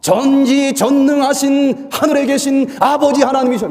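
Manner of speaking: high, loud, pompous prayer voice; mock solemn address to God; mock formal prayer. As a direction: ham up a grand formal prayer. Mocking formal grandiose prayer.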